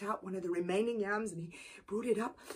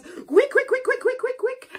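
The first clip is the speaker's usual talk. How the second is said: high, louder rapid chant; quick chirps; 11 dB louder than usual. A narrator's reading. Fast hand washing.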